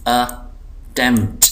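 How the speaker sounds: Attempt slow, in two parts; syllable by syllable. Shown as a demonstration. Teacher showing two syllables, slow split word. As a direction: split attempt into अ, pause, tempt. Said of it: "ये दो syllable हैं".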